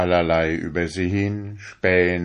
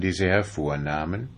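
plain reading voice

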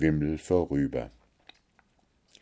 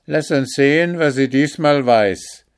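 loud, higher grudging call; loud guard voice; 8 semitones higher, 7 dB louder than usual. A narrator's reading. Another guard letting Märchen speak, loud grudging. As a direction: grudgingly give her a chance. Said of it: "sprach ein anderer".

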